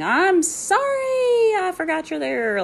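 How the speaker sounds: very high, slow, loud drawn-out apology; apologetic screaming. Retold or imitated. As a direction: wail the sorry, stretched and shrill-high. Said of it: "apologetically screaming".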